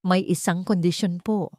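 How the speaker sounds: plain reading voice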